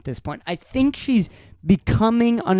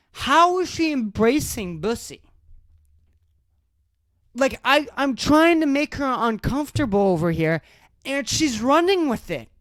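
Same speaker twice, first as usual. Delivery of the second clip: real alarmed disbelief; 6 semitones higher than usual. Real, not acted. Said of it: "never been more terrified of a woman".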